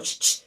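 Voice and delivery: sharp mimed "shh, shh"; imitated shushing. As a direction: imitate a mother hushing her crying child. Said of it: "you can hear her go".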